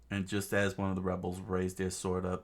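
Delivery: ordinary delivery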